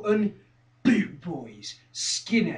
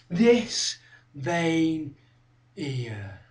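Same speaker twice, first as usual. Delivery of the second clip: sudden drop to low and soft; hushed, deliberate, falling lower; quiet menace; 5 semitones lower, 6 dB quieter, much slower than usual. Acted. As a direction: let it sink away word by word. Drops back to menace.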